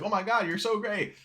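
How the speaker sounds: high-pitched gushing fan imitation; mock adoring fans. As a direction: gush high like a fickle crowd. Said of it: "We'd be like".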